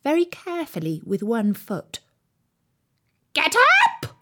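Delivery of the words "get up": high piercing royal command; shrill, loud. A narrator's reading. Queen orders the bowing gardeners to their feet, shrill and loud. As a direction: shout it shrilly, as an imperious Queen. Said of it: "in a shrill, loud voice".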